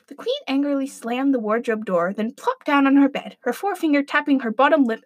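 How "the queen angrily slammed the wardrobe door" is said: plain reading voice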